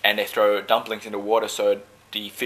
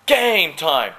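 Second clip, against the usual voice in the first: loud rallying reply; team shout; 7 semitones higher, 5 dB louder than usual. Retold or imitated. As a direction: psych the crew up. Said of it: "And the whole boat will go".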